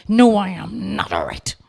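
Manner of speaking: clipped, angry reply, forced out; angry retort. Retold or imitated. To the worried instructor, an angry retort. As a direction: snap back through anger. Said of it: "I do anger".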